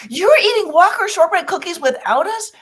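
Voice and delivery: high, loud mock outrage; mock outraged coworker. Coworker finding the hidden shortbread, high loud mock outrage. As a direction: squeal the betrayal.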